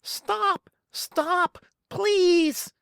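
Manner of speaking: very high desperate begging; panicked pleading. A narrator's reading. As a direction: beg them to stop. Richard begging the mob.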